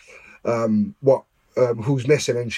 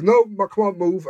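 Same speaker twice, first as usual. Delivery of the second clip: firm mock copper, no arguing; a touch of a stern officer hurrying him; insistent order; 7 semitones higher than usual.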